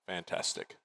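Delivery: ordinary talk, nothing marked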